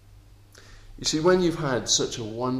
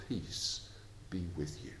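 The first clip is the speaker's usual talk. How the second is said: quieter, tender Jesus words; soft gentle peace; 5 semitones lower, 11 dB quieter than usual. Acted. Jesus greeting the disciples who failed him, softly tender. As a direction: breathe it gently like a blessing. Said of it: "hear his voice that says".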